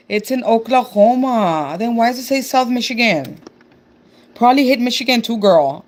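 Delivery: ordinary talk, nothing marked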